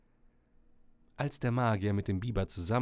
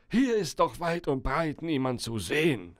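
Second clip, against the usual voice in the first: lightly acted exhaustion, higher voice; higher-pitched, weary character voice; exhausted; 4 semitones higher, 4 dB louder than usual. A narrator's reading. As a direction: complain as if worn out. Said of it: "sagte Herr Köhler erschöpft".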